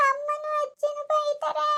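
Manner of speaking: high, slow tearful whine. Acted. Child complains to grandfather about being scolded, crying. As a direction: wail the complaint slowly through tears. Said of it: "ಯಾಕ್ ಅಳ್ತಾ ಇದ್ದೀ".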